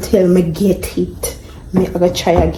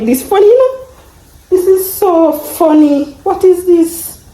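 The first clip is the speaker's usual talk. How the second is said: laughing disbelief; 10 semitones higher than usual. Real, not acted. Laughing at her own failure.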